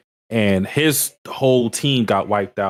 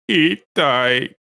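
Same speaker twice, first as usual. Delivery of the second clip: mock dying rat voice; 4 semitones higher, much slower than usual. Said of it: "acting like a queer rat and going".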